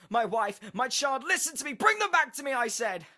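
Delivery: high, very loud frantic pleading; desperate screaming. A narrator's reading. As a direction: yell as if losing your family. Desperate man demands his family back.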